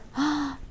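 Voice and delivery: sharp high intake of breath; delighted gasp. Real, not acted. A fan opening a gift, sharp delighted gasp. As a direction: gasp at something adorable.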